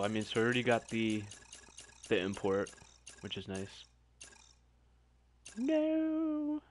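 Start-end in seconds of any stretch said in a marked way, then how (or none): 5.6-6.6 high-pitched cry of no; squeaky dismayed no; high squeal. Real, not acted. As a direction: squeal it out in dread. Dreading a bad item.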